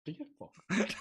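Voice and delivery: Sean Connery style Bond lisp; mock Scottish Bond accent. Friend doing a Bond accent on the podcast, mock Scottish. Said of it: "I do love James Bond".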